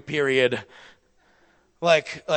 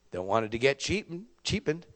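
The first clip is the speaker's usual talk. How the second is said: usual speaking voice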